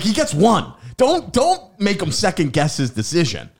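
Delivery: high, loud dad telling-off; imitated scolding dad. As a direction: lecture like an exasperated dad. Retold dad scolding, continued.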